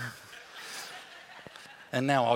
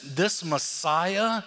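slow dramatic emphasis; much slower than usual. Acted. Preacher building toward Jesus' claim.

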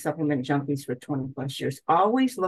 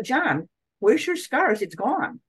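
sudden high, slow surprise at the missing scars; retelling her amazed discovery, pitched up; surprised; 7 semitones higher, slower than usual. Retold or imitated. Wife telling her husband his scars are gone, surprised.

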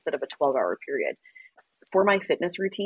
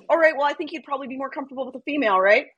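quick excuse; 7 semitones higher, faster than usual. Retold or imitated. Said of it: "River's like".

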